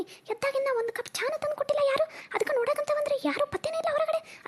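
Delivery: high, put-on complaining voice; whiny complaint. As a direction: whine high about the missing tea. Complaining nobody brought tea.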